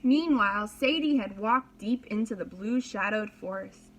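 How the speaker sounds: ordinary delivery, nothing marked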